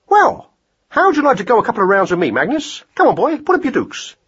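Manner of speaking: high, brisk hare voice; eager boxing hare. Acted. Hare invites mouse to box, eager and brisk. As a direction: challenge him to spar, pitched high.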